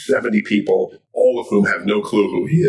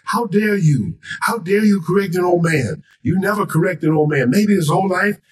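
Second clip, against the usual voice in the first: high-pitched, indignant dressing-down; outraged reprimand in a raised pitch; Muhammad Ali lecturing; 8 semitones higher than usual. Acted. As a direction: scold them firmly, point by point. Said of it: "He started lecturing them".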